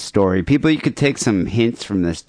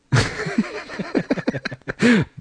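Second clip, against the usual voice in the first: high, delighted burst of laughter; host cracking up at the caller's line; 13 semitones higher, 4 dB quieter than usual. Real, not acted.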